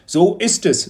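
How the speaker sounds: a teacher fishing for a reaction; knowing teacher, probing. A narrator's reading. Teacher voiced in story.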